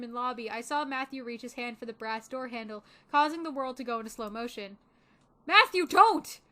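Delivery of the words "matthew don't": loud, high cry to stop; urgent warning shout. Acted. Narrator shouts across lobby to stop Matthew, urgent yell. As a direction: yell it before it's too late.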